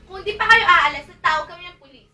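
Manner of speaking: loud angry warning; angry threat. Acted. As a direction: shout them out of the house. Threatening visitors to call police. (galit na pagbabanta)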